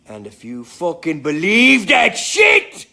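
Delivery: slow, loud bellow at the audience; hammed-up indignant shout; mock outraged shout. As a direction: yell it out slow like you're fed up. Mock outburst at the chat.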